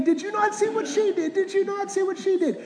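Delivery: put-on high, pleading child's complaint; high-pitched childish protest; whiny kid protest. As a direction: whine it up high like a wronged kid. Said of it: "And so I would be saying".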